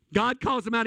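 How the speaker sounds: ordinary delivery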